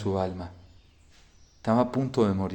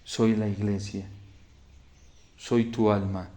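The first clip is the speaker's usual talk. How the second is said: hushed solemn revelation; 3 semitones lower, 6 dB quieter than usual. Retold or imitated. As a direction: reveal who she is in a grave hush. La doncella se revela a Hermas. (revelación solemne en voz baja)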